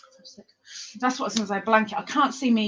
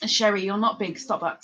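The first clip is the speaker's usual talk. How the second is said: ordinary delivery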